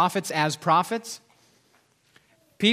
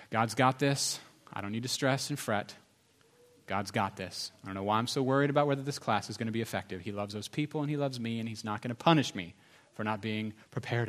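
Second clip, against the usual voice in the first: soft, lower acted apology to a wife; sincere apology; 5 semitones lower, 6 dB quieter, faster than usual. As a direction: softly make peace with your spouse.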